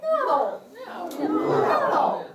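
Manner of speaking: "No" pitched very high, then falling; fourth tone. Shown as a demonstration. Teacher drilling fourth tone, high falling "No". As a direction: launch "No" high and drop it. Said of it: "start it from high pitch and go down".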